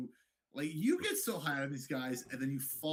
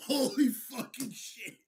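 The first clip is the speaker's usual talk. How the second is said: amazed; 9 semitones higher, 4 dB louder, slower than usual. Real, not acted. Imagining wild knockout.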